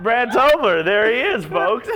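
loud, high-pitched announcer hype; mock stage introduction. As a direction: belt out a mock intro to the crowd. Mock announcing his own name on stage.

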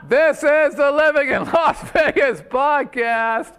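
put-on loud, high, slow Russian-accented show opening; hammed-up Russian announcer shouting the intro. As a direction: belt it out in a mock Russian accent. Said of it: "this is my Russian accent".

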